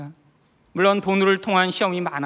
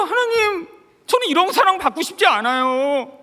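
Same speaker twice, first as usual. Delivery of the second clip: high, wailing complaint to God; imitated crying; 9 semitones higher than usual. Husband protesting to God amid wife's singing, acted crying. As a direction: plead in a raised, mock-tearful wail. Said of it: "근데 제가 울면서".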